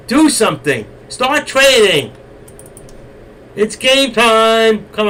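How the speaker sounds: loud, high, slow rallying call; urging shout. Real, not acted. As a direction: rouse the crowd to act. Host urging viewers to trade.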